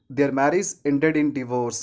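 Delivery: slow sentence, divorce starting short "di"; correct pronunciation. Shown as a demonstration. Teacher gives an example, divorce with short first vowel. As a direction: say divorce "di-vorce". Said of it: "इसलिए हम क्या बोलेंगे".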